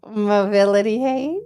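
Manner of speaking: slow, drawn-out doubtful question; skeptical repeat. Shown as a demonstration. Repeating her claim back to the woman, slow and doubtful. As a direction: drag it out like you don't buy it. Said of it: "Just like that".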